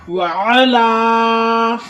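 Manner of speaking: وعلا with the laa held extra long; stretched وعلا on a level note; double-length hold. Shown as a demonstration. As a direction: recite وعلا very slowly, lingering on the final vowel. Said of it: "الا پر double آپ نے ٹھہرنا ہے".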